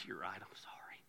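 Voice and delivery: breathy mock whisper; imagined apology. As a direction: hush it out like a meek husband. Apology he never said to wife.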